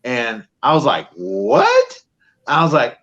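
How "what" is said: a high, disbelieving what; stunned what. Retold or imitated. Santa hearing he made the Hall of Fame, a high stunned what. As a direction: blurt it out in shock at the news.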